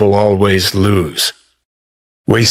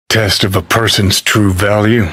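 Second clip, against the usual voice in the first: ordinary delivery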